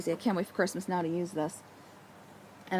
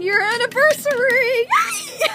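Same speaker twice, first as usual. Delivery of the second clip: much higher, louder, cheering; excited cheer; 10 dB louder, slower than usual. Real, not acted. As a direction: whoop for the anniversary. Announces anniversary episode.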